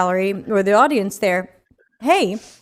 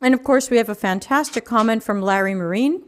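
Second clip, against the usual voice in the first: ordinary talk, nothing marked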